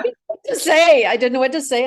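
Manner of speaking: high, loud words bursting out on a laugh; real laughter carried into the speech. Real, not acted. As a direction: laugh helplessly while explaining. Laughs at her own awkward reply.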